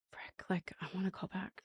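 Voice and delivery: hushed, whispered retold thought; muttering to oneself. Caller to herself after reaching voicemail, whispered. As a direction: say it under the breath, barely audible.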